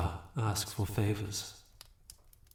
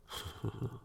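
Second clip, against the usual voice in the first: amused chuckle; 2 semitones higher, 9 dB quieter than usual. Acted. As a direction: chuckle softly at their fury. Amused at listener's fury.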